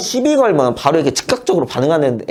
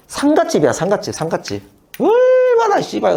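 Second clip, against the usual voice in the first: exasperated outburst; 12 semitones higher than usual. Real, not acted. Complaining drinking is like a funeral.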